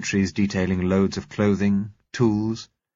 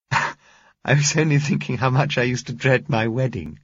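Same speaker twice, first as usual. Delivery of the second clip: higher, smiling Josella; amused Josella; 5 semitones higher, 4 dB louder than usual. A narrator's reading. Josella telling Bill a funny thought, amused. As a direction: still chuckling through the words.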